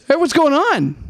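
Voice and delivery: high, bright mock greeting from the stranger; imitating an eager stranger; mock friendly stranger. Guy at the lot greets them.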